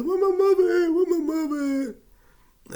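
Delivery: put-on very high loud slow wail like a five-year-old; childish wail. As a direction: cry for mama like a little kid. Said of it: "I started crying for my mother".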